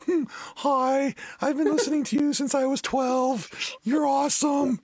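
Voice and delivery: high, gushing fan voice; starstruck fan. Pretend. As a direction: play yourself meeting your idol. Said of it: "That would have been me just".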